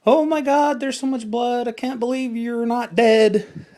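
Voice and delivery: high, loud panicked babble; mock freaking out. Showing viewers a panicking responder, high frantic voice. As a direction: act out a bystander losing it. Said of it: "you are freaking out".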